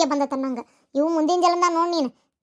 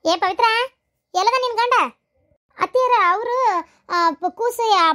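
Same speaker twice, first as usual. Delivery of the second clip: calling out; 7 semitones higher, 4 dB louder, slower than usual. Acted. Elder calls across the room.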